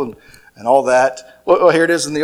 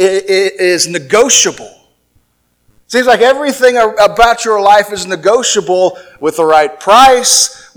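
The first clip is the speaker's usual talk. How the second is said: usual speaking voice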